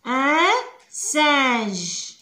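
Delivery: "ان سانج" drawn out slowly, nasal vowel held; slow spelled-out French. Shown as a demonstration. Teacher to pupils, reading the word slowly. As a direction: stretch "سانج" as a careful model word. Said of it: "القرد".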